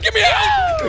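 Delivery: high loud panicked yell. Retold or imitated. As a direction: scream to get off the boat. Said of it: "He's like".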